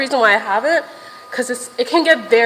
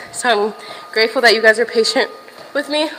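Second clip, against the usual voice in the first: ordinary delivery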